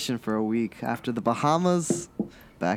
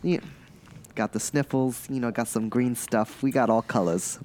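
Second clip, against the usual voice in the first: normal talking voice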